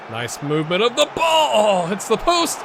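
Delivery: loud, high, thrilled yell at a near goal; excited shout. Real, not acted. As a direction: cry out as it hits the post. Near miss in the match.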